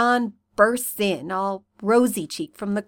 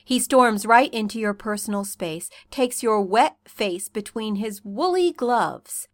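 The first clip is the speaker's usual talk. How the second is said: usual speaking voice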